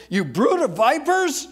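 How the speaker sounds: thundering rebuke. Acted. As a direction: let the repeat thunder at them. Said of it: "And he says here".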